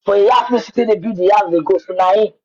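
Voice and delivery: usual speaking voice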